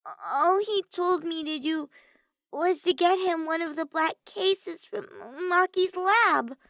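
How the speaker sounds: timid under Maki's glare; Himiko's voice. A narrator's reading. Himiko shrinks from Maki's dark look, high and timid. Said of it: "Himiko said".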